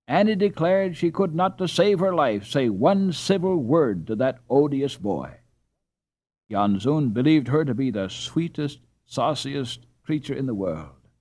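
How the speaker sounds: usual speaking voice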